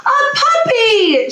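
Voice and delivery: put-on high, drawn-out little-girl squeal; delighted exclamation. A narrator's reading. Little girl spotting a puppy, high excited exclamation. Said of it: "she exclaimed".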